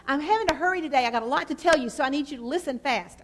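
fast, loud mock rush; hurried and loud. Shown as a demonstration. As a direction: rush it out, loud and fast, poking fun at yourself. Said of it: "I was talking really slow".

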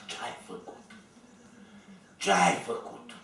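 hushed, scared whispered question; retelling the wife's scared whispered question; frightened whisper. Retold or imitated. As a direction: whisper it like she's frightened for him. Frightened wife asks what happened.

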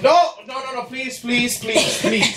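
frantic high-pitched begging; high pleading. Real, not acted. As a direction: beg her to stop, voice shooting up. Being hit, begging her to stop.

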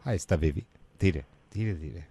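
put-on cooing boyfriend. Boyfriend cuts into his girlfriend's work call, low cooing. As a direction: coo it low to your sweetheart.

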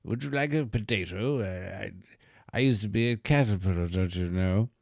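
tipsy posh English gent. Acted. Butterfly toy needling the Irish, tipsy posh English voice. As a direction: put on a plummy, posh English voice. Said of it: "he's kinda like posh English".

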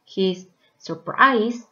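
usual speaking voice

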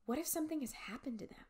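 fast, hushed whisper; fearful whisper. Acted. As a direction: breathe the dread to yourself. Fearful unspoken thought.

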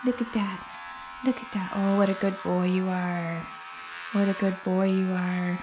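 quiet soothing pet talk; soft praise for the dog. Real, not acted. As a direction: hush him with praise. Soft praise while clipping.

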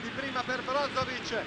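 ordinary talk

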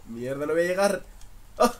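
loud frustrated outburst; frustrated shout. Real, not acted. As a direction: yell it in frustration. Game going wrong.

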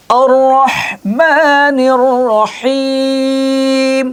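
slow, drawn-out chanted recitation; Quran recitation. Acted. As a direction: chant it slowly, stretching every vowel.

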